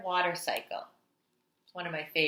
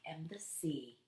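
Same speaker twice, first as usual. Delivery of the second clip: whispered reveal; 3 semitones lower, 14 dB quieter than usual. Acted. Teacher whispering the answer to the children. Hushed reveal in read-aloud.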